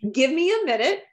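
high, playful whine broken by laughter; half-laughing mock tantrum; laughing bratty plea. Real, not acted. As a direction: giggle through asking for a moment to sulk. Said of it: "to be a little brat".

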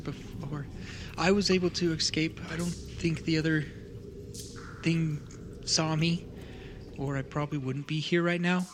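lightly voiced frightened kid, halting and stammering; a young survivor stumbling through the story; grieving child tells of the attack; scared child.

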